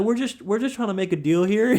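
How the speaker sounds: high-pitched, imitated placating plea; mock a salesman coaxing back a customer; sweet-talking salesman. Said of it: "he starts sweet talking my dad".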